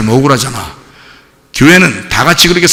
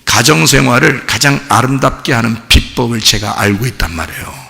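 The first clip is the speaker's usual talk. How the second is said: ordinary talk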